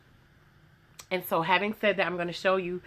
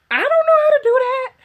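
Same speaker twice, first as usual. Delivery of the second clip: a high panicked squeal, much louder; 9 dB louder than usual. Retold or imitated. Answering eager customers, a high panicked squeal. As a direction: squeak it out like you're in over your head. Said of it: "And I'm like".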